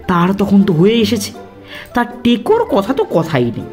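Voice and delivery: ordinary delivery, nothing marked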